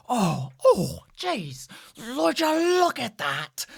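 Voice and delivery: high, slow, impressed gasp of onlookers; mock amazed onlookers. Hammed-up wonder, slow and high. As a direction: sailors gawking at the newcomer's metal arm, drawn-out amazement. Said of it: "people say".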